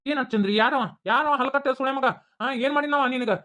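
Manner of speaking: high, loud feigned fury; hammed-up angry shouting; mock outrage. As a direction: pretend to be furious at the culprit. Man fakes outrage at attacker.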